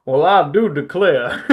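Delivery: a hammed-up Southern drawl, slow and loud; Southern accent. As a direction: play the snake as a Southern gentleman. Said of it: "I wonder if he talks in a Southern accent".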